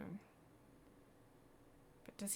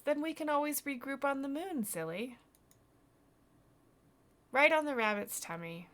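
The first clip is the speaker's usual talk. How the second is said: put-on high, loud, eager kid voice; teasing, confident boy; 7 semitones higher, 12 dB louder than usual. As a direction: say it as if it were obvious. Voices boy reassuring girl.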